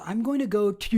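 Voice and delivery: rough Baltimore accent with a long fronted final vowel; attempted Baltimore accent. Shown as a demonstration. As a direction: try a Baltimore accent, breaking into a laugh. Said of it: "I'm not doing it right".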